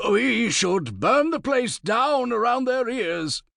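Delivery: high, slow and defiant; a proud lord refusing to back down. A narrator's reading. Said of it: "he said defiantly".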